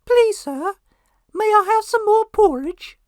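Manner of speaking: squeaky-high, loud Dickensian orphan voice; English little boy. Shown as a demonstration. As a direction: beg like an English boy in A Christmas Carol. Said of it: "an English boy from Mary Poppins".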